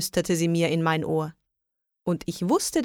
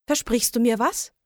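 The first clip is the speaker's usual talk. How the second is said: plain reading voice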